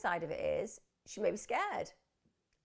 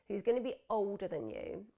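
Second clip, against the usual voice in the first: ordinary delivery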